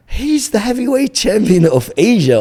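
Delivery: high, loud incredulous exclamation; alarmed warning. Retold or imitated. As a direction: imitate a friend warning in disbelief. Friend warns about the opponent.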